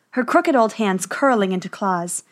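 ordinary delivery, nothing marked

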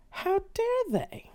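seething indignation; outraged. Acted. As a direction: stretch each word in disbelief. Anger turning to rage.

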